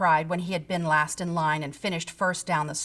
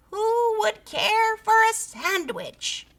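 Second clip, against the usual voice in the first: faint distant creature call; 13 semitones higher, slower than usual. A narrator's reading. Creature calls to the scouts from afar. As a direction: sing it out like a far-off lure. Voice again, closer.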